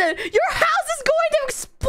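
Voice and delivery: hammed-up high, wailing threat; over-the-top aggressive warning; too aggressive. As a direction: threaten them in a high, overblown cry. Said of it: "Too aggressive".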